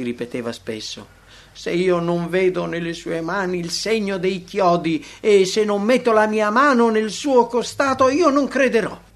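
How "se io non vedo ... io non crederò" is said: raised, louder voice of a stubborn doubter; defiant refusal. A narrator's reading. Doubting disciple repeating his conditions for belief, defiant and insistent. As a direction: insist on it, climbing higher and louder to the final refusal.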